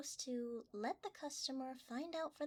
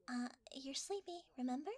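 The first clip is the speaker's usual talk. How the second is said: ordinary delivery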